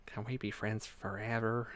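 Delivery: smitten owner. Pretend. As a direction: ask the cat sweetly, slowly. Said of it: "I'd be like".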